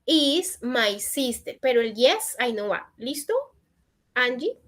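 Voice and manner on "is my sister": she is my sister spelled out slowly; slow model sentence. Shown as a demonstration. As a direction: dictate it slowly for the class. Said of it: "Ella es mi hermana, entonces". (dictado lento para la clase)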